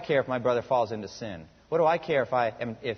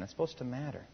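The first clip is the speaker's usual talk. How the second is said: said again much softer, almost to himself; hushed earnest echo; quiet repeat; 8 dB quieter than usual. Shown as a demonstration. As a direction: let it sink in, softly. Preacher repeats line softly for emphasis.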